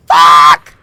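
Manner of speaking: loud, low startled curse; shouting a curse at a jump scare. Real, not acted. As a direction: blurt it out in fright. Sudden scare in game.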